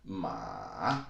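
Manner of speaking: "ma" dipping low, then rising; long falling-rising Mandarin "ma"; third tone. Shown as a demonstration. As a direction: drop "ma" into a valley and bring it back up. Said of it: "goes down into the valley".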